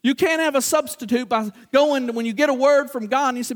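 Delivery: ordinary delivery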